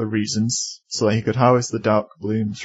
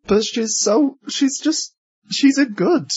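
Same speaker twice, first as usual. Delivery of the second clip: much higher, gushing voice; 13 semitones higher, slower than usual. Real, not acted. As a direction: squeal how lovely she is. Gushing about Izzy's mom.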